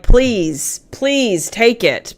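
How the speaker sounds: drawn-out, slow mock plea; playful imploring; mock pleading. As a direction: beg lightly, stretching each please. Acts imagined plea to planner mother.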